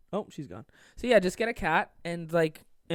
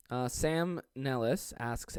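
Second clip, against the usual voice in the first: usual speaking voice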